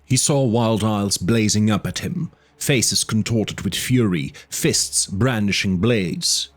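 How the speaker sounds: ordinary delivery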